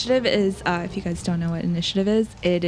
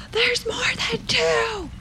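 high, slow stage whisper; loud whisper; slower than usual. Acted. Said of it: "whisper loudly to Michelle".

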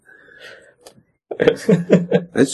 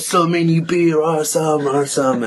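mocking American tourist; 5 semitones higher than usual. French guy mocking drunk American tourists, slow and high. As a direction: stretch each awesome like a partying bro. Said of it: "especially those American".

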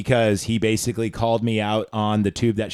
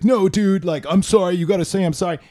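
put-on insistent drunk cousin; 6 semitones higher than usual. Drunk cousin demands an apology.

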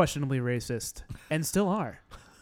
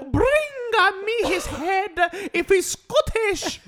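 hammed-up shout. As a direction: yell it like a cartoon tyrant. Echoing the warlord order.

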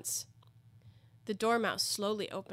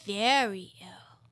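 whisper; 5 dB louder, much slower than usual. A narrator's reading. Dormouse aside.

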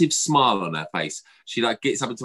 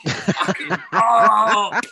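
loud laughing exclamation; laughing outburst; 11 semitones higher, 6 dB louder, much slower than usual. Real, not acted. Laughing in frustration to the cohost.

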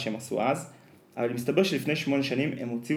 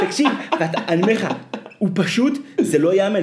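higher and louder, laugh still in the voice; excited, still laughing; 7 semitones higher, 5 dB louder, faster than usual. Real, not acted. Telling a friend about the show, excited and higher. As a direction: gush about how unbelievable it was.

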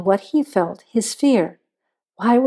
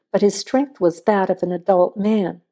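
plain reading voice

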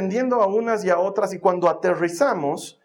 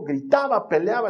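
normal talking voice

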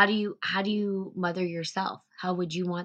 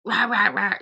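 mock barking rah rah rah, louder and higher; brusque drill-sergeant mother, hammed up; harsh mothering; 4 semitones higher, 6 dB louder than usual. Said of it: "approaching me like".

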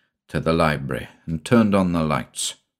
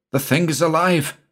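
high-pitched, startled cry; alarmed exclamation; 9 semitones higher than usual. A narrator's reading. Shula warning Godfrey the snake is alive, alarmed exclamation. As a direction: blurt it out in horrified alarm. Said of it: "exclaimed Shula".

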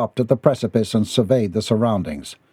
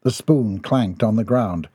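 usual speaking voice

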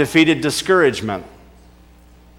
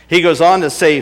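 ordinary talk

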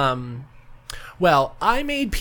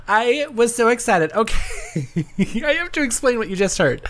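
ordinary talk

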